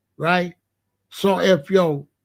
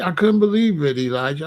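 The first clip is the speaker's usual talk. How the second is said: ordinary delivery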